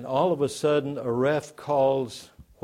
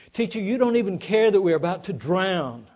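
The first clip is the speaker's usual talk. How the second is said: higher and louder, alarmed complaint; panicked angry cry; 6 semitones higher, 7 dB louder than usual. Retold or imitated. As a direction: disciples crying out to Jesus as the boat sinks. Disciples angry, storm, waking Jesus.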